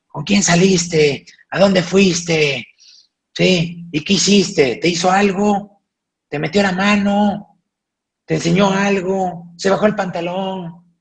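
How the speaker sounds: high, loud suspicious grilling; mock parent interrogating the older sister. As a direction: fire questions like a nosy parent. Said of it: "y dicen".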